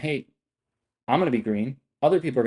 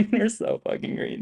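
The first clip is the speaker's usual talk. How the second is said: laughing; 9 semitones higher than usual. Real, not acted. Laughing at chat being green.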